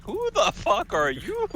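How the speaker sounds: high squeaky bug creature voice. Retold or imitated. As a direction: screech it like a tiny cockroach. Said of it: "with the bug voice from, uh, T F S".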